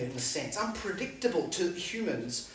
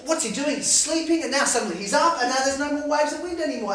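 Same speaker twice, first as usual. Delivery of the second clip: ordinary delivery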